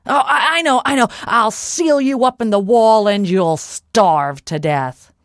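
loud giant seized by a new idea; gloating giant. Acted. Giant threatens to wall Molly up, loud excited menace. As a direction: burst out high and loud, then press the threat.

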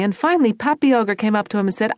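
plain reading voice

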